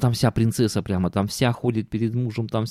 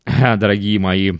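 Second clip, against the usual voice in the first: ordinary delivery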